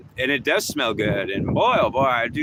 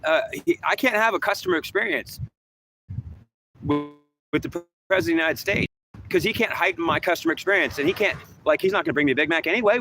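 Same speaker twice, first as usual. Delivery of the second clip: higher, hammed-up impression of the president; lightly mock the president, pitched up; mocking the president's indifference, voice pitched up; mock Biden; 7 semitones higher than usual. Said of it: "Mister Biden is saying".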